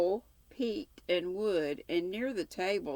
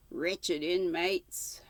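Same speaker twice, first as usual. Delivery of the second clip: slow mental curse; much slower than usual. Acted. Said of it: "I ejaculated mentally".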